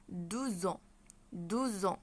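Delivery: "دوزون" linked with a buzzing Z. Shown as a demonstration. Teacher shows how to say two years, "دوزون" with Z. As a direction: run deux into ans as "دوزون", Z between. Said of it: "الاكس هننطقها زد".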